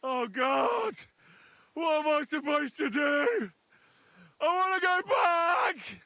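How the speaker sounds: panicked crying out. Acted. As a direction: beg to go back, terrified. Panicking in the water.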